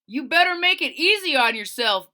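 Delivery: yelling. Acted. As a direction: yell it at her like a bully driver. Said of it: "Blake yelled".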